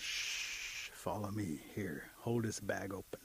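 slow, low whisper; secretive whispered instructions; hushed sneaking. Acted. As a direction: shush him and whisper the orders. Mole sneaking into girl's house.

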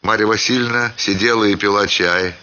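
ordinary delivery

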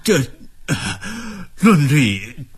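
halting, stuck on each word; stammering. A narrator's reading. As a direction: stammer it out nervously. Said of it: "结结巴巴地".

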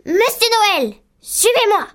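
voix de directrice furieuse, beaucoup plus aiguë; elle crie son ordre d'une voix haute et perçante; hurlement de la directrice. Acted. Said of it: "Elle hurla". (la directrice hurle sur le maître, voix aiguë et furieuse)

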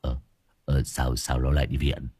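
low, soft, put-on stammering; low fake stammer hiding his joy; faked stammer. A narrator's reading. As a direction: stutter in mock worry. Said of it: "giả vờ giả vịt lắp bắp".

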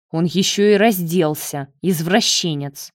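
usual speaking voice

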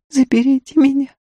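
high-pitched, desperate woman's plea; imploring. A narrator's reading. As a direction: beg for rescue, distraught. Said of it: "умоляюще схватила за руку".

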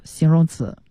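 ordinary talk, nothing marked

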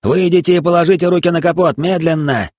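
loud, high command shout; police shout. Acted. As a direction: bark the order at a suspect.

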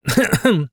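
short acted cough; pointed little ahem; clearing the throat. A narrator's reading. Passenger clears her throat before addressing the driver, coughing. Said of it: "прокашлялась Фанвизина".

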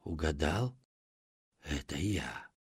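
very soft, low monster voice; eerie monster whisper. Acted. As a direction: breathe it low like a lurking monster. Monster reveals itself.

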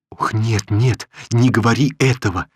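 usual speaking voice

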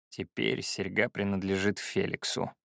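low, soft, matter-of-fact reply; calm admission. Acted. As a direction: state it quietly against the ranting. Pupil calmly admits earring is gone.

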